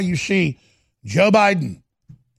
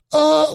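mock grunt; 10 semitones higher, 6 dB louder than usual. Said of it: "she starts going".

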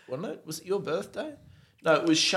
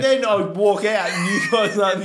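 shouting laughing; 5 semitones higher, 4 dB louder than usual. Retold or imitated. Retold complaint shouted.

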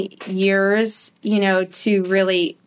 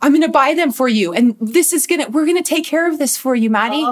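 higher, hurried placating; imitated frantic mom; 6 semitones higher, 3 dB louder than usual. Mom comes running back with designer jeans, imitated frantic reassurance. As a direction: rush to fix it with promises.

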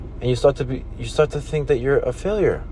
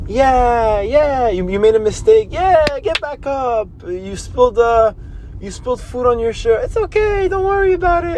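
put-on high, sing-song reassurance to a baby, climbing higher toward the end; supportive grown-ups; 6 semitones higher than usual. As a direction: coo at a toddler who just fell, much higher. Everyone voicing support to a baby.

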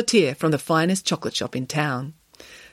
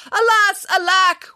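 loud, high theatrical wailing; badly acted cry of despair; hammed-up wail; 6 dB louder, slower than usual. A narrator's reading. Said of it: "wailed Missus Heseltine".

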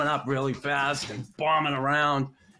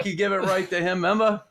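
normal talking voice

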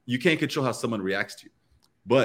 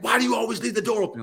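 mock accusing roommate, high and fast; hammed-up overreaction at an open door; overreacting; 10 semitones higher, faster than usual. Acted overreacting roommate quote.

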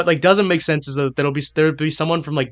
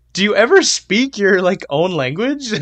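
higher, louder bewildered mock question; incredulous mock question; 5 semitones higher, 5 dB louder than usual. Imagines asking fluent Europeans about their language, incredulous. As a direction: ask it in playful disbelief. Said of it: "I'm just like".

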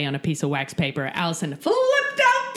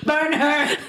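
higher, louder mock mob cry; mock witch-hunter; 8 semitones higher, 4 dB louder than usual. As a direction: play a villager calling for the witch to burn.

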